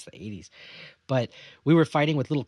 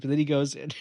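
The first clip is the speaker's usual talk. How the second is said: normal talking voice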